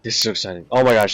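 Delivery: ordinary talk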